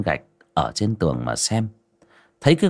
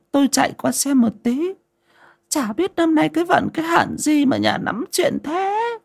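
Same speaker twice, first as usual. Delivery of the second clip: put-on high-pitched old woman lamenting; elderly woman's high, worried complaint; fretting old wife. A narrator's reading. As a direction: grumble anxiously about bad luck.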